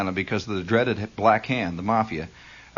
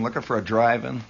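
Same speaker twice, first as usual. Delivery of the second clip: usual speaking voice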